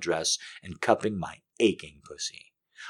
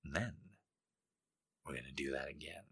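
soft whisper; 5 semitones lower, 20 dB quieter, faster than usual. Acted. Lover's soft quick whisper.

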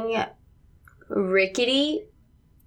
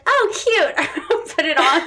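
delighted; 8 semitones higher, 4 dB louder, slower than usual. Pretend. Imagined reaction to the hat.

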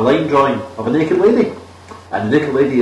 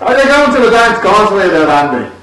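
high, louder hammed-up Irish brogue; comedy Irish accent; 7 semitones higher, 4 dB louder than usual. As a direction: ham up a stage Irish accent. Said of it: "I wish you sounded more Scottish".

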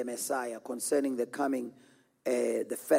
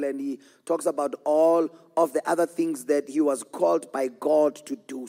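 usual speaking voice

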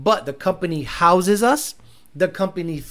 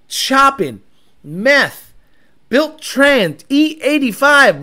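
louder and higher, rattling off the build; hyped up; 5 semitones higher, 5 dB louder, much slower than usual. Real, not acted. Hyping a built car to viewers, loud and pitched up. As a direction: get louder and higher as you list it.

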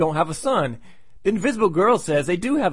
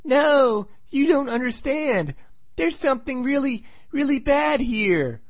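mock kid voice, higher and drawn out; pleading kid; 9 semitones higher than usual. Kid warning the grown-ups that something is bad, high pleading child voice. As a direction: plead like a worried little boy, higher pitched. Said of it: "but the kid says".

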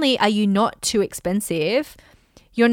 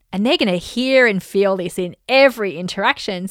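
ordinary delivery

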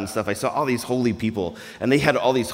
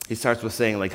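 ordinary delivery

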